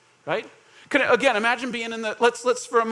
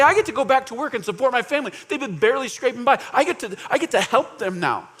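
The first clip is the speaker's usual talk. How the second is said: raised, impassioned protest; emotional pushback; 4 semitones higher, 3 dB louder than usual. Acted. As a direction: argue back with feeling, pitched up. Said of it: "Emotionally, I think they would push back".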